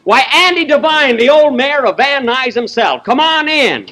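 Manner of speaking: high, excited welcome; pleasantly surprised host; delighted greeting. Acted. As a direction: hail an old pal with pitch lifted high.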